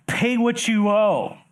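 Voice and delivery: slow, loud, high demand; angry demand. Acted. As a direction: bark it like a creditor choking someone. Servant choking his fellow servant.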